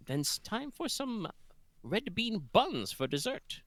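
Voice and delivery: high, slow sing-song; sing-song offer. Acted. Cook offering buns to guests, high sing-song. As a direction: coo it like a kindly host offering sweets. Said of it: "sing-song offer of dessert".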